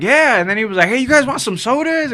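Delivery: high, eager voice for the cashier; imitating a cheerful drive-thru worker offering free stuff; eager drive-thru guy.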